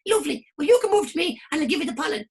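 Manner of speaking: squeaky bargaining flower; high flower voice. Acted. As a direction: coax the other flower over.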